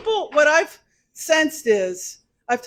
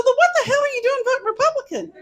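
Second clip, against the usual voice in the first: incredulous laughing question; 12 semitones higher, 4 dB louder, faster than usual. Retold or imitated. Incredulous retold question to a voter.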